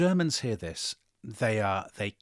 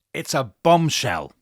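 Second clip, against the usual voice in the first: dramatic reveal; 2 semitones higher, 7 dB louder than usual. Retold or imitated. Dramatic reveal of telegram news.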